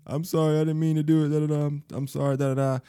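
mock the boy's tearful apology; imitating crying apology. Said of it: "He crying on the phone and just, you know, sniffling".

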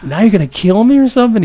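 high, loud, panicky question; acted alarm. Retells fearing friends will kill him.

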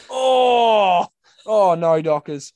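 high, loud despairing commentator groan; mock groan. Imitating Ben Allen on radio, groaning at the Dockers. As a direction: groan over the team's mistake. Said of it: "He'd just, like, groan".

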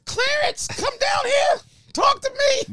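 shrill mock woman calling out; high woman calling. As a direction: call down the stairs in a falsetto. Voicing woman calling from upstairs.

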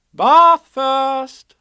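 bright, loud, high motherly call; cheerful. A narrator's reading. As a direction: cheerfully announce bath time. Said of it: "said Kanga in a cheerful voice".

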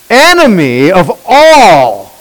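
loud, slow, stretched-out denunciation; emphatic preacher quoting an accusation; ratcheted-up rebuke. Acted. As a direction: ratchet it up, drawing out each stressed word. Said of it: "He just ratchets it up a second time".

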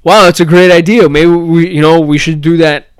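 loud, high Israeli accent impression. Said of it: "in an Israeli accent".

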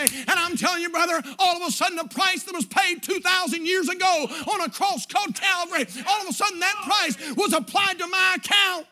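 high, loud, half-sung preaching. Acted. Preacher tells the church his price was paid, sung preaching. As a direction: whoop it up like a revival preacher.

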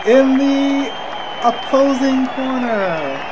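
hammed-up ring announcer, long held syllables; mock boxing intro, each phrase stretched out; Michael Buffer impression. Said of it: "I'm trying to do Michael Buffer".

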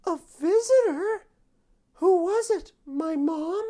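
high, eager questioning; high hopeful prisoner. Acted.

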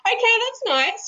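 high, mock polite brush-off; imitating a politely baffled American guest, pitched high; a lightly voiced polite American. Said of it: "The American's like".

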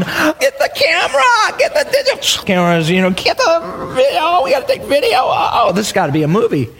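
high, excited, laughing yell for the camera; hammed-up thrilled parent calling for video. Parents excited by baby's first step, shouting happily. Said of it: "they're going".

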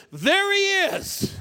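high, loud, drawn-out call; bishop spots him. Retold or imitated. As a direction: point him out, stretching it high. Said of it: "and he goes".

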